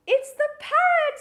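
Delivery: high, loud delighted announcement; exaggerated children's-presenter excitement; excited reveal. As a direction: burst out with the surprise for children. Revealing the animal at the door.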